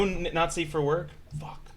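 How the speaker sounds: normal talking voice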